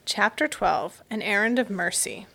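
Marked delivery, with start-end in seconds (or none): none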